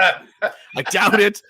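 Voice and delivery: slow words through a laugh. Real, not acted. Scoffing about the charges, slow laughing doubt.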